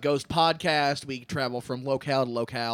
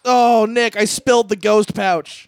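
panicked; 5 semitones higher, 11 dB louder, slower than usual. Acted. Panicked spill reveal.

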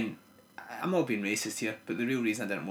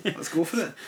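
raised, louder mock rallying cry; mock patriotic cheer; 6 semitones higher, 5 dB louder than usual. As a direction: cheer like a hammy Braveheart rally. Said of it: "I was like".

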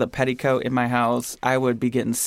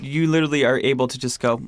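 ordinary talk, nothing marked

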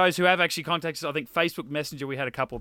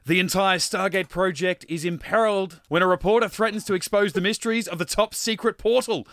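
dramatic trailer read; 4 semitones higher, 5 dB louder than usual. Acted. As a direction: read the blurb like a hammed-up film trailer. Reads DVD blurb as trailer.

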